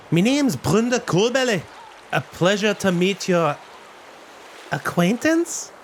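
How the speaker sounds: put-on high gruff-sounding old dwarf woman. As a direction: speak up high as the weathered dwarf. GM voices the dwarf.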